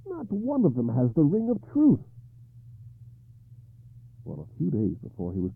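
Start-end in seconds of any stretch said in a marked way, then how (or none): 0.1-2.0 higher and louder, despairing; despairing king. Acted. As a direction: lament the false answers. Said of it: "he asked in despair".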